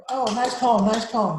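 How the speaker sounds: excited praise. Acted. Mice cheering Frederick's poem, high and loud.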